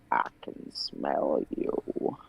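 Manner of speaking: low unvoiced creature whisper; creepy whisper. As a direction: breathe it like a lurking monster.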